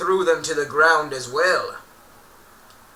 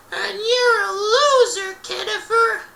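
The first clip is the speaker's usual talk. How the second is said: much higher, slow mocking bully voice; squeaky insult; 7 dB louder than usual. Justin insults Kenneth in a high mocking voice.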